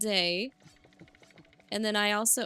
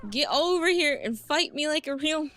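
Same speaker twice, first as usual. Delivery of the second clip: louder repeat of the taunt; louder mock challenge; 5 semitones higher, 6 dB louder than usual. Real, not acted. Gamer taunting the game enemy, louder. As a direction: call the enemy out harder.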